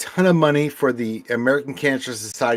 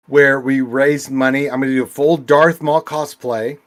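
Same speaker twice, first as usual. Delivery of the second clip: normal talking voice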